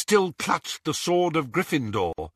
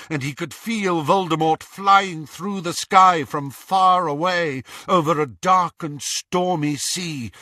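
ordinary delivery, nothing marked

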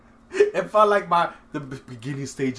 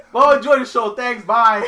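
loud mock sign-off; 8 semitones higher, 13 dB louder than usual.

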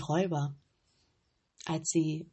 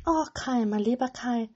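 longing sigh; 5 semitones higher, 4 dB louder than usual. A narrator's reading. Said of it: "seufzte Gerda".

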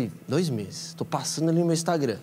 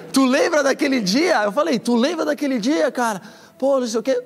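much higher, louder, laughing questions; excited laughing; 11 semitones higher, 6 dB louder than usual. Retold or imitated. Asking the DJ if he remembers that day, laughing. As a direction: gush with joyful disbelief. Messaging the DJ, overjoyed.

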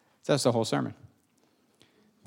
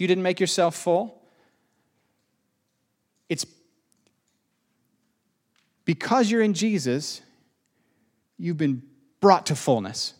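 usual speaking voice

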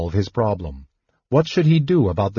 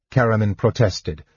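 ordinary delivery, nothing marked

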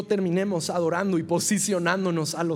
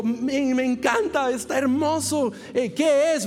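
higher, gushing dad voice, hiding confusion; overdone fatherly praise; 7 semitones higher than usual. Retold or imitated. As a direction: lay on the praise to the kid. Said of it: "Y le digo". (papá elogiando exageradamente el dibujo de su hijo)

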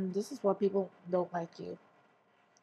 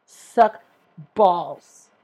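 sudden much louder yell; yelled insult; 2 semitones lower, 13 dB louder than usual. Retold or imitated. Ranting at the company, suddenly yelling. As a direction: bellow the insult to close the rant. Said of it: "I'm like".

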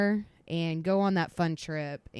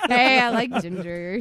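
laughing, louder and higher; 4 semitones higher, 8 dB louder than usual. Real, not acted. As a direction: giggle through the line. Laughing flirt line.

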